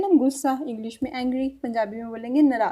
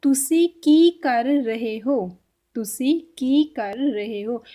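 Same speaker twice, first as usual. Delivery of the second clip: slow, careful Punjabi question; slow teaching demo; much slower than usual. Shown as a demonstration. Teacher showing students the Punjabi question, slow and careful. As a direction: spell it out slowly for learners. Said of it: "इसको पंजाबी में कैसे बोलेंगे?".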